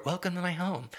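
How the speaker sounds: mock Southern accent. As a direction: lightly imitate the bad accent. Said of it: "the bad Southern accent".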